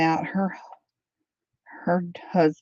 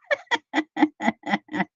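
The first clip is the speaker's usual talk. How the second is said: laughing; 9 semitones higher than usual. Real, not acted. Laughing at a friend caught out, real laughter. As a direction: bubbly run of chuckles.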